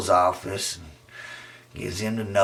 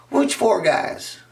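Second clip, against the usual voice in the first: put-on high girlish voice; 11 semitones higher, slower than usual. Emily, high and slow.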